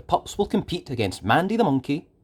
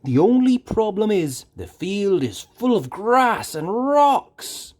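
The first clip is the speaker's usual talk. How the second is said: higher, louder friendly farmer's warning; 8 semitones higher, 4 dB louder than usual. A narrator's reading. Farmer Al warns the pups about rocks, higher and louder. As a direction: tip off the pups like a kindly old farmer. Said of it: "warns Al".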